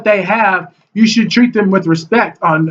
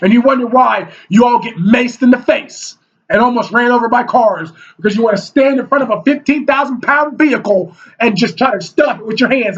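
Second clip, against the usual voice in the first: ordinary talk, nothing marked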